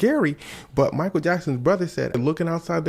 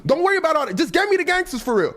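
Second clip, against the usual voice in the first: high insistent Michael; 13 semitones higher, faster than usual. Acted.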